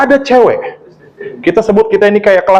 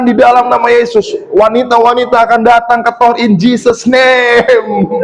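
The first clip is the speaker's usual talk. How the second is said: high, insistent declaring prayer; re-enacted faith declaration; 7 semitones higher than usual. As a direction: proclaim it, drawing out the ending. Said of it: "kita declare".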